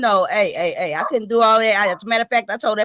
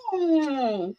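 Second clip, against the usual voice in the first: ordinary talk, nothing marked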